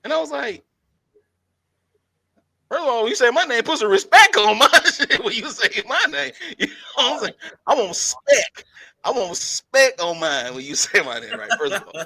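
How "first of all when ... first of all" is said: higher, louder mock-indignant retort; hammed-up indignation, punching the key words; indignant demand for respect. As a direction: demand respect with playful outrage.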